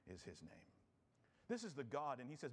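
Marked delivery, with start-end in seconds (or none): 0.1-0.7 slow, hushed voice of the ocean; slow softer words fading away; ocean speaking. Pretend. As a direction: murmur it low and slow like waves. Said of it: "in ocean speak".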